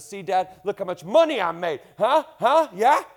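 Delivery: put-on taunting grown child.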